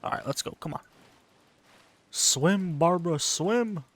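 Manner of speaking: usual speaking voice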